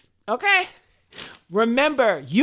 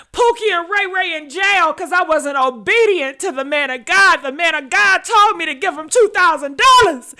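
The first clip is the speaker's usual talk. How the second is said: loud, high, half-crying mock wail; hammed-up sobbing plea, louder and higher; mock wailing churchgoer; 5 semitones higher, 6 dB louder than usual. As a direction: ham up a frantic believer crying out. Mocking a frantic churchgoer's wailing.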